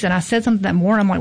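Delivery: ordinary delivery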